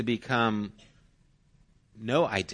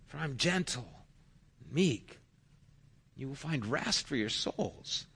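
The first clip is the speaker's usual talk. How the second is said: gentle invitation; 6 semitones higher, 5 dB louder than usual. Acted. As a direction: offer rest like a comforting shepherd. Quoting Jesus' invitation.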